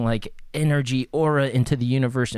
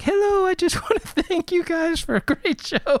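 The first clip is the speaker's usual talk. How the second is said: high, polite falsetto; mock a gentle guy, pitched way up. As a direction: innocent little thank-you voice. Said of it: "most soft-spoken, quiet guy ever".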